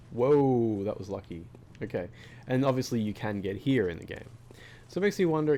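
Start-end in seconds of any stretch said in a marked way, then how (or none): 0.1-0.8 loud startled whoa; surprised whoa. Real, not acted. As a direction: jolt at a close call. Near fall in game.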